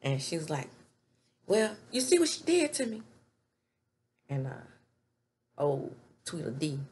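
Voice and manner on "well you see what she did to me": higher put-on voice, playing the victim; mock complaining woman. Deja pointing at her injury to Zach, high mock whine. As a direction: whine for sympathy.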